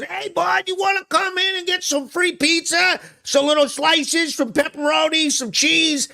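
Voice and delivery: high, eager mock voice of the pizza guy; hammed-up generous pizza owner; imitating pizza owner. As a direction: pitch way up like an overeager host. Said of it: "He goes".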